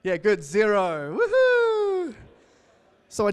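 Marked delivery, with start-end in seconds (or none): 0.0-1.2 sarcastic. Retold or imitated. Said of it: "guess how many sales". 1.2-2.1 high sarcastic woohoo; a shrill fake whoop of celebration; mock cheer.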